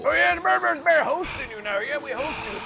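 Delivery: high, loud boasting; excited villain boast. Acted. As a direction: crow about hosting.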